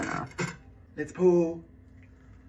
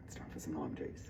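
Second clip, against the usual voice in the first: whispered; 10 semitones lower, 15 dB quieter than usual. Acted. Joking aside.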